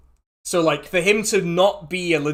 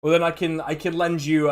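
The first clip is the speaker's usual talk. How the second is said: ordinary delivery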